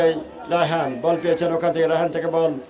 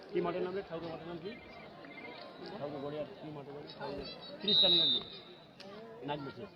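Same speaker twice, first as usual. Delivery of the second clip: off-mic aside; 16 dB quieter, faster than usual. Real, not acted. Off-mic aside asking a name.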